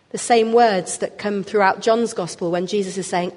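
ordinary talk

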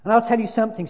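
loud, high-pitched protest; self-righteous big ghost, indignant. A narrator's reading. Ghost defending his life to the spirit, loudly indignant. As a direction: insist on your good record, hard and loud.

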